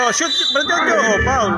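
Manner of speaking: loud, high cry at the play; real courtside outburst; excited play call. Real, not acted. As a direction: call the shot and foul excitedly. Live reaction to the play.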